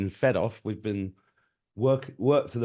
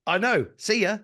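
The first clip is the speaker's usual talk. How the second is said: high, loud breezy brush-off; cheeky goodbye; 12 semitones higher, 6 dB louder than usual. Retold or imitated. Telling the tax lady goodbye, breezy and cheeky. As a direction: toss it off like you couldn't care less. His cheeky reply on phone.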